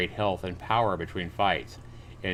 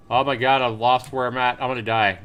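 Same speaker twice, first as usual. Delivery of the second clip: panicked; 4 semitones higher, 7 dB louder than usual. Real, not acted. Lost while swimming, about to die.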